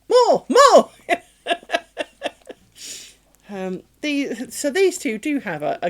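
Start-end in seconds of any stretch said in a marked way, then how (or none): 0.1-1.2 much higher, louder laughing more; eager laughing shout. Retold or imitated. Begging to keep playing tug, laughing loud and high. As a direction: yell it like an overexcited puppy. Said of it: "And I'm like".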